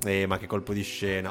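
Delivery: sarcastic. Shown as a demonstration. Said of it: "penso sia sarcastica".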